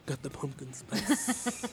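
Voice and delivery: slow, soft late-night radio voice; sexy radio voice. Pretend. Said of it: "You should say that in a sexy radio voice".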